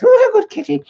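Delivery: high, cooing pet voice. Real, not acted. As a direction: coo up high at the kitty like a doting owner. Talking to the cat.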